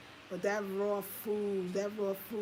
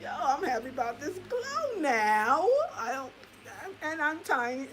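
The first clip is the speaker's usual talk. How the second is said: mock happy dieter; 8 semitones higher, 5 dB louder than usual.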